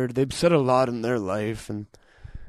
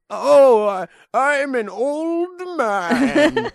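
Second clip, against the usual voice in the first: put-on much higher, very slow, stretched old man; American old man; 13 semitones higher, 4 dB louder, much slower than usual. Showing the American old-man voice, high and very slow. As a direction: quaver it out like a frail old man. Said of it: "What's our old man?".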